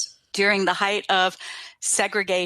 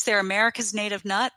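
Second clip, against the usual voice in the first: ordinary talk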